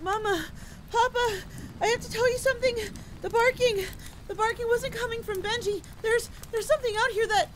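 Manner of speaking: very high, loud panicked warning; frantic shouting. Acted. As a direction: shout the warning desperately until cut off. Child frantically warns parents, cut off.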